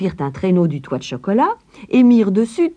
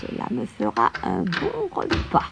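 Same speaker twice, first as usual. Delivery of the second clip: higher, soft gloating crone; greedy witch; 5 semitones higher, 4 dB quieter than usual. A narrator's reading. Witch eyeing Hansel's cheeks, soft high gloat. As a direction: savour the thought of the meal. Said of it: "se dit-elle".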